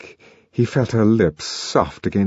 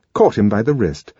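ordinary delivery

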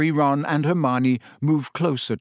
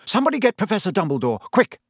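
fast, high, urgent order; Percy, suddenly sharp; 7 semitones higher, much faster than usual. A narrator's reading. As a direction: call sharply for help, rushing the words. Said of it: "in a suddenly sharp voice".

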